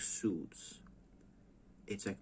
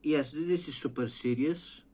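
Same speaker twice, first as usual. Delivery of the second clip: higher, louder mock earnest voice; a light send-up of a stiff British host; 6 semitones higher, 4 dB louder than usual. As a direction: play the prim co-host. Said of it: "He's like".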